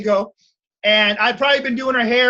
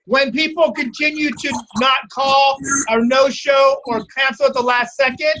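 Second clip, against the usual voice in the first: normal talking voice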